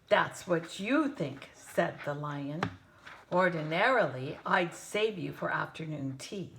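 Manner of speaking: threatening lion. Acted.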